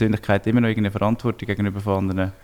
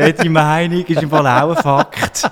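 slow, drawn-out higher parody voice; mock-preachy; 4 semitones higher, 5 dB louder, much slower than usual. Co-host joining the joke about opinions, mock-preachy. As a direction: loudly parody a dim slogan.

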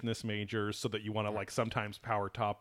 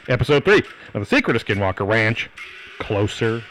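announcer voice; 16 dB louder than usual. Acted. Hammed-up menu-style show intro.

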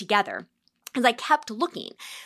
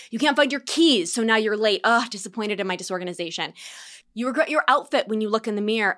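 normal talking voice

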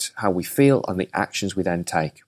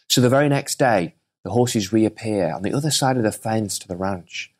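normal talking voice

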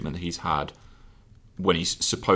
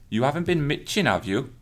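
Welsh accent; 6 semitones higher than usual. Shown as a demonstration. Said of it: "you're funny with accents".

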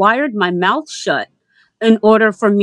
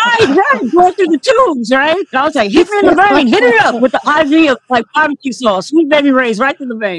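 high, loud and giddy; excited; 7 semitones higher, 3 dB louder than usual. Real, not acted. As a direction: hype it up, squealing with glee. Excited hammed-up pitch.

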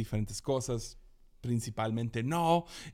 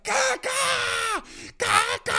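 very high, loud shrieks; mock toddler screaming caca; imitating toddler scream; 7 dB louder, slower than usual. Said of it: "Y empieza a gritar".

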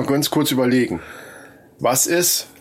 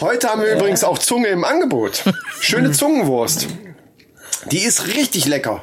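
butcher voice. Pretend. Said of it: "rede wie ein Fleischverkäufer".

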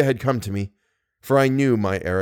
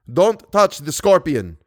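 slow, higher, emphatic command; urgent warning; 5 semitones higher, 4 dB louder, slower than usual. A narrator's reading. Narrator warns Christine off the scorpion, slow urgent call. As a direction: warn her slowly and firmly, pitched up.

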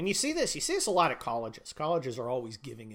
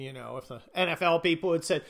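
ordinary talk, nothing marked

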